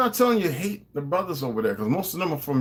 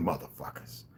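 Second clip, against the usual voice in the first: ordinary talk, nothing marked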